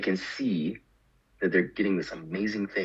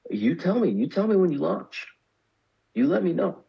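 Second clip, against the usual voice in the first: friend's eager request; 10 semitones higher than usual. Retold or imitated. Friend asking to be told when they launch, high and eager. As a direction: sound keen to hear back soon. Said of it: "She's like".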